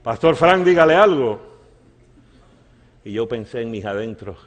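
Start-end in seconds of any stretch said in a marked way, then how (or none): 0.0-1.4 loud, impatient-sounding prompting; raised tone. Retold or imitated. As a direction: imitate someone insisting in a raised voice. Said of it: "en un tono más subido".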